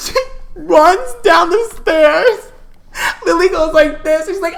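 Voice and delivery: high voice squeezed by real laughter; laughing while telling. Real, not acted. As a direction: struggle through the story while cracking up. Teller helpless with laughter.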